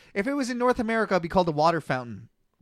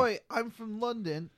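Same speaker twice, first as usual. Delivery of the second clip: slow, soft mock London accent; jokey London accent, quiet and slow; British accent; 3 semitones higher, 8 dB quieter than usual. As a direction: drop into a cockney Londoner with Oi. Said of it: "That's a pretty good accent".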